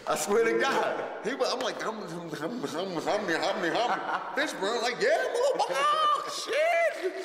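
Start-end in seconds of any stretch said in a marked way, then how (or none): 1.8-4.2 laughing mumble. Retold or imitated. Rapper who forgot the words, humming through with laughter. 5.0-7.1 much higher, louder, laughing yell; wild shout. Retold or imitated. Fishburne cheering the one-take video, laughing high shout.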